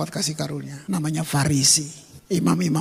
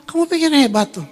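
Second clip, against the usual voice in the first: mocking rebuke; 7 semitones higher, 4 dB louder, faster than usual. Mocking the self-righteous.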